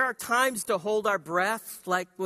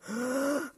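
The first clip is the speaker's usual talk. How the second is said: big gasp; 8 semitones higher than usual. Shown as a demonstration. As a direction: suck in a huge breath. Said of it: "Everybody take a big breath".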